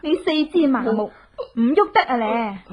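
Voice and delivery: normal talking voice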